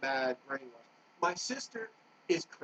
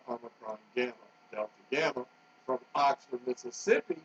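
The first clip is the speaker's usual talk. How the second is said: usual speaking voice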